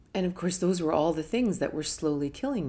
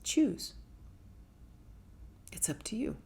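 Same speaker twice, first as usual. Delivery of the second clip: inner voice; 4 semitones higher, 7 dB quieter than usual. Retold or imitated. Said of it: "the voice said".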